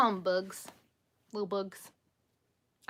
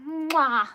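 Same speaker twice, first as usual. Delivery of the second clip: loud, high "mwah" kiss noise; blown kiss; 7 semitones higher, 7 dB louder than usual. Real, not acted. Streamer signing off to viewers with a loud blown kiss. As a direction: send a big smacked kiss to the listeners.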